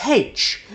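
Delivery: "H" said as haitch, pitched high. Shown as a demonstration. Explaining how the Irish name the letter. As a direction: lift "H" up as haitch. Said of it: "they actually pronounce the letter as".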